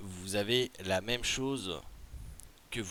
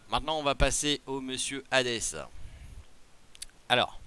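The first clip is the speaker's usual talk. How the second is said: ordinary talk, nothing marked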